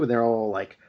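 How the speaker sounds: ordinary talk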